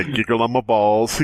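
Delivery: normal talking voice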